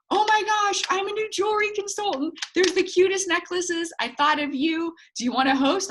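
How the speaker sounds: very high, gushing, thrilled friend; squealing sales enthusiasm, loud at the start; excited pitch. Shown as a demonstration. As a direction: burst out with hammed-up excitement. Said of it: "It's excited, not desperate".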